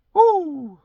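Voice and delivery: high, loud woo; excited whoop. Real, not acted. Shaking off a heavy thought in his truck, with a loud whoop. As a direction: let out a happy whoop. Breaking his own rambling mood.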